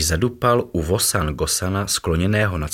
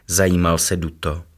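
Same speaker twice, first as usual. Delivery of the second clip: usual speaking voice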